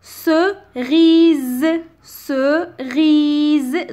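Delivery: "Cerise" broken up with a long final syllable; slow, separated syllables on "Cerise", held z; split into syllables. Shown as a demonstration. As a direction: sound out "Cerise" as ce, then a stretched riiise. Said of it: "donc la dernière syllabe".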